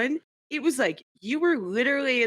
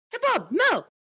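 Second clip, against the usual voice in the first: much higher, gushing hello; excited greeting. Retold or imitated. Friend mimics Uber spotting Bob, high excited greeting. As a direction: greet old friends with a burst of joy. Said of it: "Uber typically is like".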